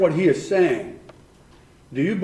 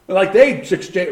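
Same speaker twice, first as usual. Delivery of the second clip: usual speaking voice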